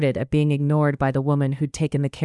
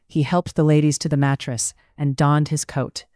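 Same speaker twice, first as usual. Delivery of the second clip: usual speaking voice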